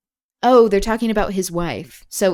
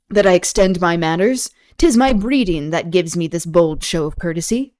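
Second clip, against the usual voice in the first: dramatic reading; 6 dB louder than usual. Acted. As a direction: declaim the greeting to the lady. Reads Othello aloud.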